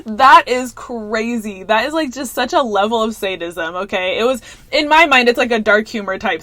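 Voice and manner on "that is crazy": slow, laughing "That is crazy"; drawn-out amused disbelief. Retold or imitated. Laughing at a shocking plot twist, slow and amused. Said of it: "literally laughed".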